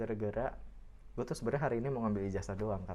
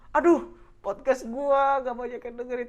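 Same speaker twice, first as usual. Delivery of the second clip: whiny podcaster; 12 semitones higher, 7 dB louder than usual. Retold or imitated.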